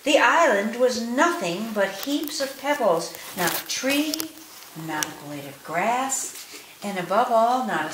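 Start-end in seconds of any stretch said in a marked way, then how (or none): none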